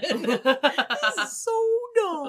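put-on high whiny kid voice, laughing; kid impression. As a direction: laugh while whining like a kid. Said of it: "I like this impression".

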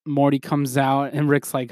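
ordinary delivery

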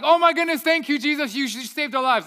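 hammed-up relieved praise; mock gratitude. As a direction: disciples gushing over Jesus, mock-grateful. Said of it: "the reaction isn't like".

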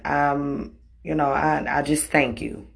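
plain reading voice

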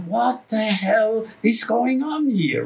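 aghast at the screaming crowd; bewildered outburst; stunned disbelief. Retold or imitated. Shocked at wild rock concert.

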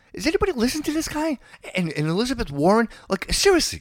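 can you believe this guy; incredulous disbelief. Real, not acted. Exasperated rant about candidates.

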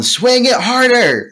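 high, slow, loud yell. Retold or imitated. Band teacher Mister P yelling across the room, a high slow yell. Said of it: "just yelling".

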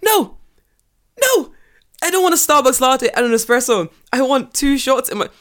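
loud, very high-pitched protest; acted-out fed-up yelling at a barista; exasperated outburst. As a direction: shoot the pitch up in mock outrage.

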